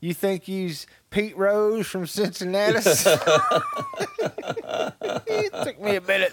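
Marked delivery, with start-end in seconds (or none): 0.0-2.8 high, hammed-up hillbilly retort; appalachian accent. 2.8-5.2 big high laugh at own joke; high cackling laugh. Real, not acted.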